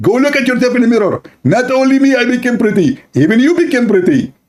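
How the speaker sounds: husband insisting both got pretty; insistent husband. Acted. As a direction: push her to the mirror.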